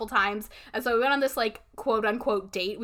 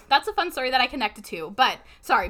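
ordinary delivery

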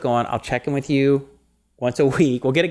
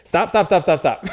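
higher, louder rapid-fire stops; mock-panicked string of stops; urgent self-interruption; 6 semitones higher, 4 dB louder than usual. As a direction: cut yourself off urgently. Acted self-command to stop refactoring.

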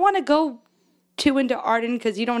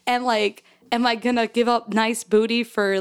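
ordinary talk, nothing marked

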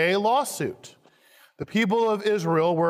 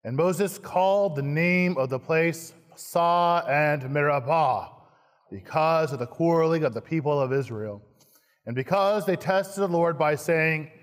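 ordinary delivery

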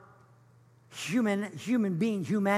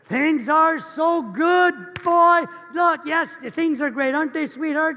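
higher and louder, sugary-bright put-on cheer; fake cheerful greeting; 5 semitones higher, 5 dB louder than usual. Couple caught fighting greets their pastor, gushing fake cheer. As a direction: hide the fight behind a big happy hello.

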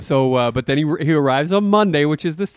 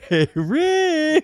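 high call of a name, much higher; mock call; 14 semitones higher than usual. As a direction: sing out the name to get his attention. Imitating Shelley calling out.

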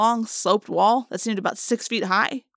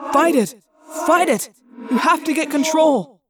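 urgent shouting; 8 semitones higher, 6 dB louder than usual. Acted. Urging friend to fight morph.

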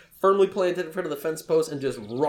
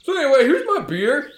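put-on high, loud child voice; hammed-up eager child character; Elmo; 13 semitones higher, 10 dB louder than usual. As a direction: announce it like an excited little kid. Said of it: "Elmo is in the front".

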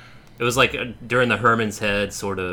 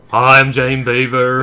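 put-on sitcom voice; 2 semitones higher, 8 dB louder than usual. Parodying the sitcom intro again.

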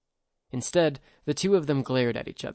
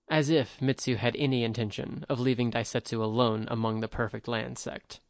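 ordinary delivery

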